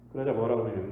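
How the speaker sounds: usual speaking voice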